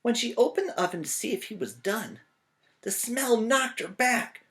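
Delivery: lively read-aloud for a class; storybook narration. A narrator's reading. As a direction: tell it like a picture book to kids. Reading picture book aloud.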